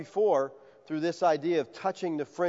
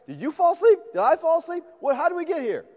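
high, louder, bewildered disbelief; stunned disbelief; 11 semitones higher, 4 dB louder than usual. Acted. Disciples baffled after the storm, high bewildered disbelief. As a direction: wake up baffled in the calm boat.

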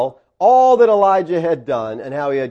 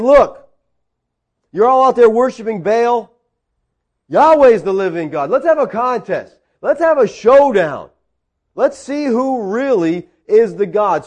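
loud, high-pitched call to a showdown; bold challenge; 7 semitones higher, 5 dB louder than usual. Acted. Elijah to the Baal worshipers, a bold, raised-voice challenge. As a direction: throw down the challenge, high and forceful.

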